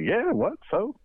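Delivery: high, loud and defiant; mock defiant. Murtaugh defying the embassy clerk, loud and high. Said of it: "he's, he's like".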